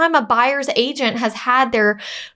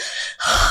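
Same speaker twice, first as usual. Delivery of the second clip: heavy sigh; 4 dB quieter than usual. Retold or imitated. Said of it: "you could hear him like".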